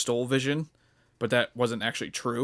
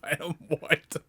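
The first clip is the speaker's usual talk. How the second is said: laughing mock boast; 5 semitones higher, 6 dB louder than usual.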